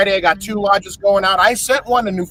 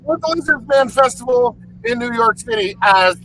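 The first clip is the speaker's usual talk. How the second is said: loud, high hype-man shout; 4 semitones higher, 5 dB louder than usual. Pretend. Host hyping the Lizard Man festival in a loud, high shout. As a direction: pump up the crowd like a promoter.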